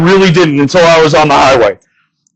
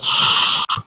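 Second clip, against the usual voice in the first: gasp; 11 dB quieter than usual. Retold or imitated. Smelling weed, dramatic gasp.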